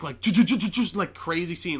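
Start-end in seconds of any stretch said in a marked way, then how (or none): 0.2-0.7 loud, higher stuttering crash noise; stuttered impact sound effect; imitated crash noise. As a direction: imitate a car crash in rapid bursts. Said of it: "like, loud, gigantic".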